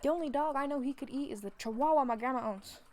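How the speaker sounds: usual speaking voice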